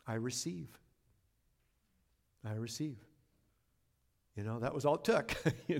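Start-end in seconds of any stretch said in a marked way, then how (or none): 0.1-3.0 soft, hushed prayer of surrender; quiet prayer. Retold or imitated. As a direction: murmur it like a private prayer. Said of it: "all I said to him was".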